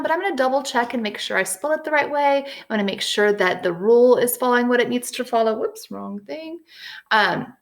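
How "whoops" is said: ordinary delivery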